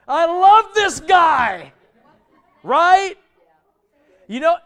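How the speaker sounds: loud laughing delight. Real, not acted.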